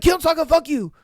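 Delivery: high, loud mock fury; imitating an outraged boy; pissed off. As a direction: hurl it out in a squeaky rage. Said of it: "gets pissed".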